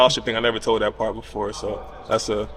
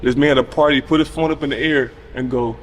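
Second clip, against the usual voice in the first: usual speaking voice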